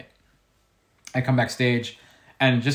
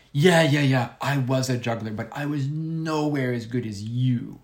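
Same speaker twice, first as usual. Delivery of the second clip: lightly voiced old lady, slowing at the end; a hint of an old circus performer's careful English; old woman; 3 semitones higher than usual. Old juggler modestly praises the performer, lightly imitated old woman. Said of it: "And she goes".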